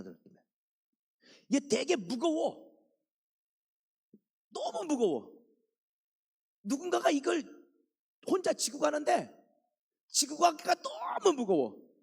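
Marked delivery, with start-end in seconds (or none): 1.5-11.9 higher, strained voice under a heavy load; preacher miming carrying a burden, straining; straining under weight. Shown as a demonstration. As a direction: groan it out as if carrying something heavy. Acting out a crushing burden.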